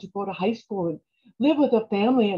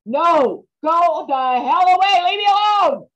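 louder and higher, yelling go away; angry shout; 6 semitones higher, 5 dB louder than usual. Acted. Daughter yelling at aunt and mother to go away. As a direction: shout her off from behind the door.

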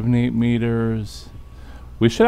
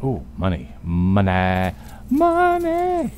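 greedy excitement; much slower than usual. Real, not acted. Finds loot in secret room.

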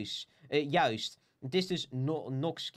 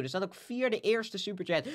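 ordinary talk, nothing marked

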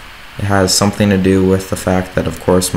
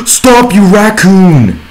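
gasping, much higher, loud yell; angry shout; 13 semitones higher, 10 dB louder, much slower than usual. Real, not acted. Shouting at a raccoon stealing his stuff, furious. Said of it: "I was angry".

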